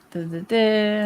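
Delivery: ordinary talk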